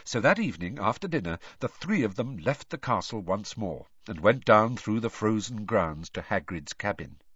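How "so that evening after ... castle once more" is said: ordinary delivery, nothing marked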